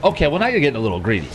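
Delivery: ordinary delivery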